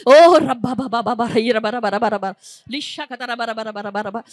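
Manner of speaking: rapid babbling syllables, pitch sliding down each run; speaking in tongues. Real, not acted. Preacher leading the congregation in tongues, ecstatic babble.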